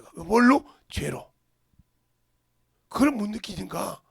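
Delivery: loud, punchy sermon delivery, hammering each point; fiery preaching. Real, not acted. Pastor preaching hard at the congregation, loud and insistent.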